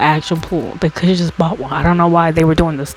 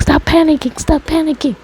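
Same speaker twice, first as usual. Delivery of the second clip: mock stern dad snapping, much higher; dad's impatient order; 9 semitones higher than usual. Dad at the pool, telling his scared four-year-old to stop panicking, in a mock stern voice. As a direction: bark it at the panicking kid. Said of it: "he was like".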